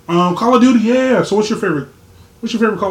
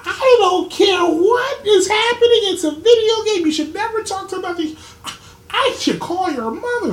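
scolding mom; 5 dB louder than usual. Retold or imitated. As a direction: lecture like an outraged mother, hammed-up. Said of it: "His mom left me a voicemail saying".

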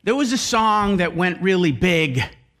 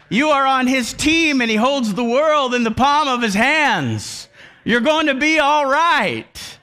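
loud, higher preacher's call; preaching at full force; 5 semitones higher, 3 dB louder than usual. Acted. Preacher rallying the congregation, loud proclamation. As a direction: lift the room, proclaim it.

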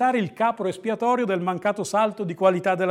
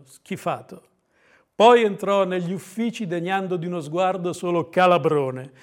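ordinary delivery

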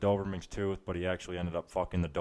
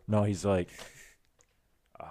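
normal talking voice

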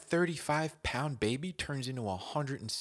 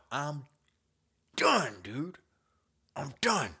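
defeated whine; 5 semitones higher, 4 dB louder, slower than usual. Retold or imitated. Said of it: "I'm like".

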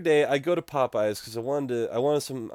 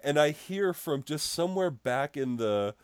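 ordinary delivery

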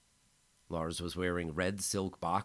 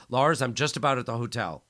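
louder, higher; anxious friend arriving at the hotel; urgent call; 7 semitones higher, 11 dB louder than usual. A narrator's reading. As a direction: hurry him on the phone. Calling while arriving.

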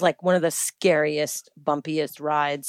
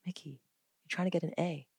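hushed, much softer counsel; imitating Lauren Zander; 5 dB quieter, faster than usual. Advisor to woman wanting pregnancy now, hushed gentle correction. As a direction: quietly set a friend straight. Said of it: "she's like".

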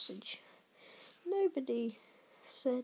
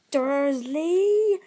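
very loud, higher, drawn-out boom; 4 semitones higher, 12 dB louder than usual. A narrator's reading. Hagrid about to explode at Dursley, booming. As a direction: bellow the surname. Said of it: "he boomed".

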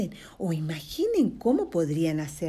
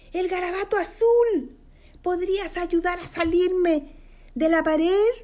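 high, louder little creature voice; tiny thin voice; 11 semitones higher, 5 dB louder than usual. Acted. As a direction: plead for help in a tiny high voice. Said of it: "una voz finita". (vocecita finita y aguda pidiendo ayuda)

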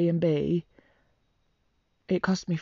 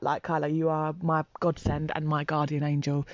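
ordinary delivery

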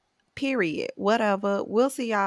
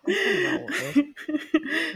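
high, slow words through laughter; giggling while admitting it; laughing; 9 semitones higher, slower than usual. Real, not acted. Laughing at own joke.

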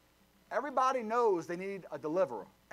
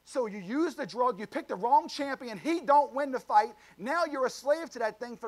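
emphatic preaching; 5 semitones higher, 4 dB louder than usual. Real, not acted. Preaching climax.

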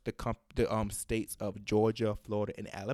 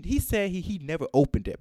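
ordinary delivery

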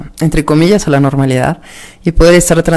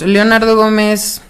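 ordinary delivery

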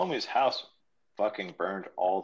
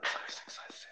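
much softer background whisper; whispered sitcom background chant; 10 dB quieter than usual. Pretend. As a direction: whisper it like a sitcom laugh track. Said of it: "I'd hear that in the background".

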